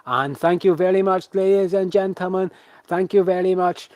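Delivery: high, loud showman sign-off; ringmaster's thanks. Acted. Ringmaster thanks ladies and gentlemen after bows, showy announcer voice. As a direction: project grand thanks to the whole audience.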